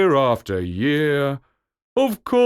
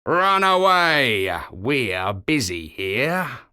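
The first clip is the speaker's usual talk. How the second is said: slow, gruff brush-off; dismissive old walrus; slower than usual. A narrator's reading. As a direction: wave the pup away lazily. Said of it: "shutting his eyes".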